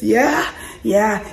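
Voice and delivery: ordinary delivery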